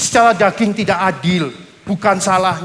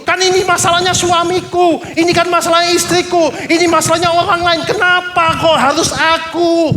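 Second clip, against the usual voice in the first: higher, loud protest that it is unfair; indignant protest; 8 semitones higher, 3 dB louder than usual. Acted. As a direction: Simon crying out at the injustice, voice pitched up. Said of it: "Mungkin Simon ngomong seperti itu".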